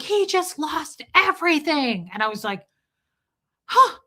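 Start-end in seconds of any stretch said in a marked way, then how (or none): 0.0-2.1 high, mildly raised mock outburst; retold screaming. Lady defending the baby to mother, imitated screaming. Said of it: "she screams that at me". 3.7-4.0 very high, loud startled "huh"; shocked gasp. Retold or imitated. Mother reacting to being screamed at, mock shock.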